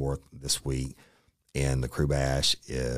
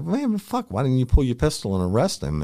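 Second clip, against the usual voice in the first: much higher and louder, exasperated disbelief; incredulous outburst; 10 semitones higher, 6 dB louder than usual. Retold or imitated. Cop shouting at his buddy in disbelief, exasperated. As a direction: throw up your hands in disbelief. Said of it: "and I was like".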